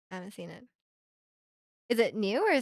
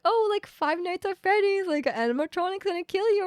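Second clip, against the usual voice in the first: mock fun-horror hype; 8 semitones higher than usual. Streamer giving examples of fun horror, mock spooky hype. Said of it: "there's, like, fun horror".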